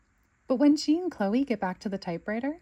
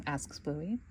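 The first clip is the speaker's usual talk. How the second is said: plain reading voice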